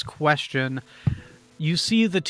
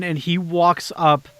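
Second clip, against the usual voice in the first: usual speaking voice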